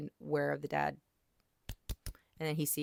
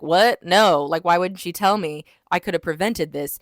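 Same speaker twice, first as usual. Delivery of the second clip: acted angry disbelief; 10 dB louder than usual. Acts out pissed hero confronting brothers.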